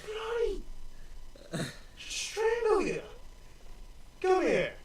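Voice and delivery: very high, slow strangled dwarf threat; a furious little dwarf lunging at him; angry dwarf. Acted. Dwarf threatens the gate guard, very high and slow. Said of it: "you see this dwarf go".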